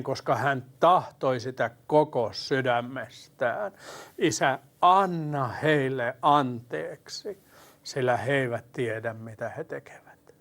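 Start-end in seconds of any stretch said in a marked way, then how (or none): 4.2-10.3 slow, fading into a whisper; slow earnest plea. Retold or imitated. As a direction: draw it out, then let it die away. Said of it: "Uskon, että hän huusi näin". (hidas, hiipuva rukous)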